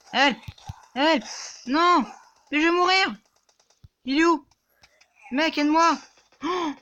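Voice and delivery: high, loud desperate yelling for help; panicked cries. Real, not acted. Gamer dying and screaming at his teammate, panicked. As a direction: scream for your teammate to save you. About to die in game.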